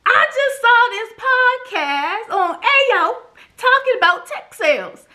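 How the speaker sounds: bursting with excitement to mama; excited call to mom. Retold or imitated. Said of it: "I said".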